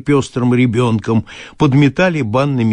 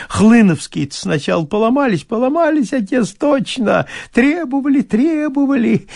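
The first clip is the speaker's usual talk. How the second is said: higher, fawning peasant fuss; 7 semitones higher than usual. Acted. Bailiff frets to his master, high and fawning. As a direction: plead and fret like a servile bailiff. Bailiff reports land deal.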